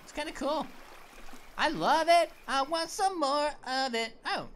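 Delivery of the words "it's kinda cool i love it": usual speaking voice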